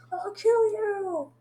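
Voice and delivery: high, slow, strained battle cry; mock furious threat. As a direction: stretch it into a high-pitched imitated rage. Voicing a character's furious threat.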